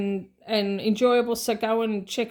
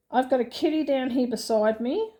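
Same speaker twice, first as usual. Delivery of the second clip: normal talking voice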